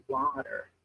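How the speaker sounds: "water" with a wide-open American mouth and a flapped r; American accent. Shown as a demonstration. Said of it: "if we're gonna do an American accent, you elaborate the word".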